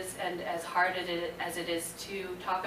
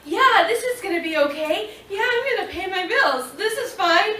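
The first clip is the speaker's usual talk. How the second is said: high, loud, half-laughing forced confidence; nervous laughing self-reassurance; 12 semitones higher, 8 dB louder than usual. Retold or imitated. Quitting her job, reassuring herself in a high, laughing panic. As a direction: talk yourself into it through panicky laughter.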